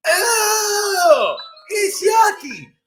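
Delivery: high, loud playful ew; mock disgust. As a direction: pretend it's gross for the kids. Mock disgust in song game.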